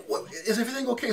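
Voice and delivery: high, soft, quick question; hurried tentative check-in; puzzled driver. Retold or imitated. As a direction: imitate a concerned driver. Puzzled driver checking on laughing passengers.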